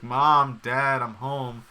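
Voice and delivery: loud, drawn-out call to parents. Retold or imitated. Imitating a son announcing he's home to his parents, calling out. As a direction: call through the house, stretching the words.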